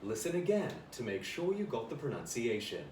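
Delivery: automated-sounding English-accented lesson voice; language-app autopilot in an English accent, words evenly set apart. Shown as a demonstration. E-learning narrator drilling Chinese students, English accent on autopilot. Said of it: "I have to do, like, an English accent".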